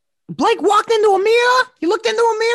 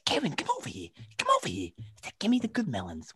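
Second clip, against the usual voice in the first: very low, hushed quote; retold order; 14 dB quieter than usual. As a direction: drop right down to a near-murmur. Retelling her order to store clerk.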